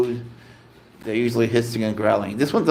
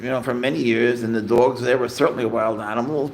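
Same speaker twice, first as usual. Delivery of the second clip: ordinary talk, nothing marked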